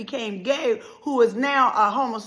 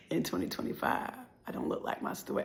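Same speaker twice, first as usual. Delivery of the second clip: near-whispered, softer; hushed; 13 dB quieter than usual. Real, not acted. Telling the church her story, hushed. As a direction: let it fall to a breath. Dropped soft reflection.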